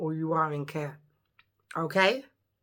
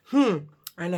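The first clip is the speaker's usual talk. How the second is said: usual speaking voice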